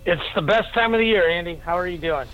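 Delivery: ordinary talk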